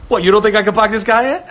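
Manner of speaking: fast, loud indignant challenge; mock-offended shout; hammed-up offended shout from the ad. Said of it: "Funny commercial".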